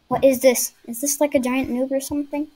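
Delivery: ordinary talk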